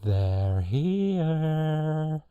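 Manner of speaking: loud sing-song, rising high and held; movie quote impersonation. Acted. Host straight into the microphone, eerie drawn-out film line. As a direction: stretch it out, sliding up to a long high note. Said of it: "straight into the microphone for this one".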